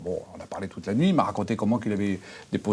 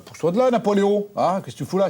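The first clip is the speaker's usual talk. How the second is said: retold snap at dog; 10 semitones higher, 4 dB louder than usual. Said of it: "j'ai dit".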